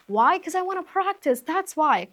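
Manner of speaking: high-pitched, loud comeback to critics; defiant retort. Acted. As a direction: fire back at the critics, pitched right up. Acted retort to her critics.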